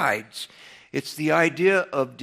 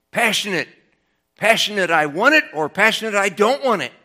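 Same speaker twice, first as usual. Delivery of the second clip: passionate emphasis; 5 semitones higher, 7 dB louder than usual. Shown as a demonstration. Preacher acting out heated passion.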